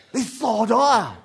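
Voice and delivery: high, loud, drawn-out cry; mock alarm. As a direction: shout a battlefield warning. Acts alarmed shout on battlefield.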